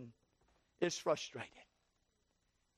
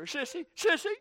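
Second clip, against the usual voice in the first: a hint of a fed-up husband venting, climbing to a high, loud rant; mock phone gripe that swells louder and higher; griping to sister; 7 semitones higher, 7 dB louder than usual. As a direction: whine it out, building to a shout. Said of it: "Call your sister".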